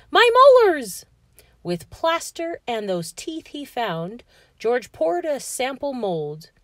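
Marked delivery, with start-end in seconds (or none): 0.1-1.0 high, loud storybook yell. A narrator's reading. George finds his teeth in a chest, yelling. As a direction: whoop it out in acted amazement. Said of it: "leaped up and yelled".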